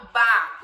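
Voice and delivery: "Bá" loud and drawn out; blended syllable. Shown as a demonstration. Teacher blending B and A for a child, loud. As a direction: punch "Bá" out clearly. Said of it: "O som do B e do A".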